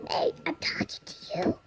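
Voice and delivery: fast, whispered complaint with a whimper; whining whisper. Real, not acted. Child complaining to David who ignores her, fast whiny whisper. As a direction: hiss it quickly, hurt and ignored.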